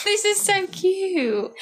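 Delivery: high, slow cooing; cooing over it. Real, not acted. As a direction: gush like it's the cutest thing. Cooing over childhood video clip.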